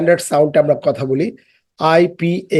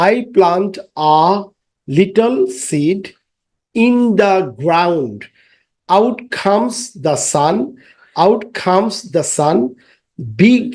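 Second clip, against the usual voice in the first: very slow, word by word recitation; slow rhyme reading; 2 semitones higher, much slower than usual. Shown as a demonstration. Teacher reciting the poem slowly to pupils. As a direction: drag each word so children follow.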